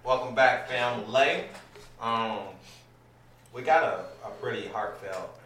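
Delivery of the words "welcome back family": loud hyped-up opener; hype intro. Real, not acted. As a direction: shout it like a show intro. Hyped show opening call.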